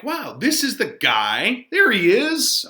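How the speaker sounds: ordinary delivery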